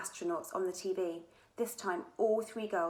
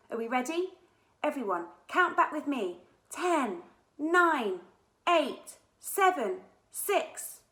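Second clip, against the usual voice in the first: countdown call; 7 semitones higher, 7 dB louder, slower than usual. A narrator's reading. Leading a launch countdown.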